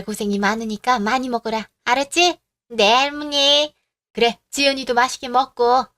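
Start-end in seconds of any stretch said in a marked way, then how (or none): 2.7-3.7 put-on slow, higher sing-song kid's reply; a child answering sweetly. Granddaughter answering her grandmother, higher and drawn out.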